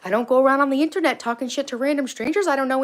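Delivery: normal talking voice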